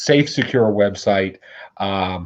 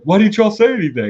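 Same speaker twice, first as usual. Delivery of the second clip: mock bewilderment; 11 semitones higher, 4 dB louder than usual. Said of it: "And he's like".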